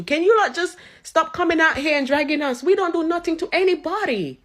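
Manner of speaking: usual speaking voice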